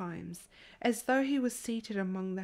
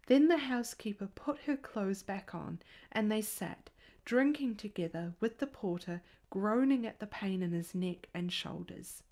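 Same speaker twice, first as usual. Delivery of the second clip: ordinary delivery, nothing marked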